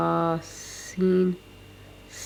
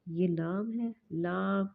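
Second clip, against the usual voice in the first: ordinary talk, nothing marked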